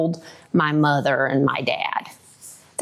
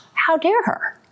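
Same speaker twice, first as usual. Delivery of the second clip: higher, indignant huff; indignant thought; 10 semitones higher than usual. Retold or imitated. Daughter thinking about her mother's words, higher and indignant. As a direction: take offense. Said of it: "because I thought".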